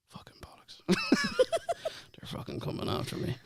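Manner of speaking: hushed, near-whispered mock paranoia; muttered cursing. Hearing an Irish accent abroad, muttered mock dread. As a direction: swear under your breath as if followed.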